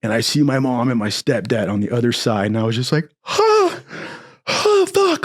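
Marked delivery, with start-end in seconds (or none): 3.2-5.2 high squeaky panicked huh; panicked gasp. Retold or imitated. Jailed son gasping at mom and stepdad, high panic. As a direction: gasp in shock at seeing family.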